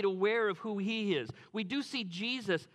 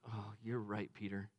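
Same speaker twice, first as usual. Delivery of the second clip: tender Jesus; 8 semitones lower, 5 dB quieter than usual. Pretend. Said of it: "a part of Jesus ... that just went".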